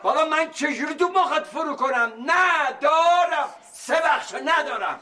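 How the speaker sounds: father shouting to his son that he has no money; desperate shout. Acted. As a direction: cry it out in despair.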